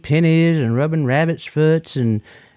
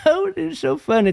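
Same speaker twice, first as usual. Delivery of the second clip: laughing through the words, pitched up high; can't stop laughing at the memory. Real, not acted. Laughing at his friend's joke.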